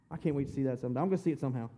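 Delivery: low, soft personal aside; quiet aside. Real, not acted. Preacher confiding his wish to see the miracle, quiet aside.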